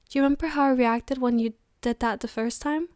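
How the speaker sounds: normal talking voice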